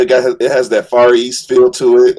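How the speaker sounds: normal talking voice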